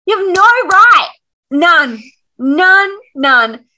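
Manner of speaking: a high, loud, fast scolding, hammering none; indignant yell. Retold or imitated. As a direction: tell him off with outrage. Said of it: "I'm like".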